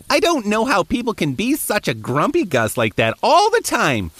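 normal talking voice

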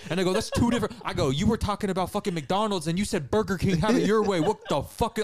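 worked-up, high-pitched comic tirade; retold angry rant. Retelling his outburst at a coworker over a joke, angrily exasperated. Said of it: "I got so angry at him".

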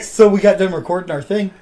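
ordinary delivery

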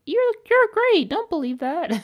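a hammed-up burst of cheering; mock peppy cheer. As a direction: gush encouragement at her. Encouraging the cashier.

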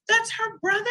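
high, amazed question after a gasp; gasp, then ask in disbelief; mock astonishment; surprised.

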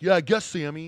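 menacing loud reply. Retold or imitated. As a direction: bark it like a threat. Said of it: "And he's like".

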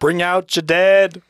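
loud, slow drawn-out call of Bring out your dead; movie plague cart call. Retold or imitated. Friends quoting a film, slow loud plague-cart call. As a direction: call out slowly like the cart man collecting bodies. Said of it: "I love that scene".

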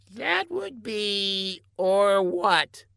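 high, very slow, careful reply; hesitant scientist underling. A narrator's reading. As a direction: admit it reluctantly, stretching the words.

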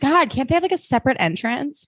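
high, bored disdain; snooty mean girl. Retold or imitated. Mean girl complaining about classmates, high and disdainful. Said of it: "she's like".

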